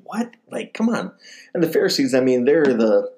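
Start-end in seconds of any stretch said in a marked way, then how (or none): 0.0-1.1 high, disbelieving scoff at the scene; an outraged host who can't believe his eyes; incredulous scoff. Retold or imitated. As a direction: toss it off in mock disbelief, pitched up. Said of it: "And he was like".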